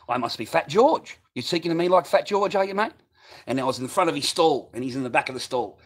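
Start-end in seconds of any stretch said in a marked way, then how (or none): 0.1-2.9 indignant, higher and louder challenge; reenacted affront, pitch climbing; retold confrontation. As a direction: throw the accusation back at him loudly.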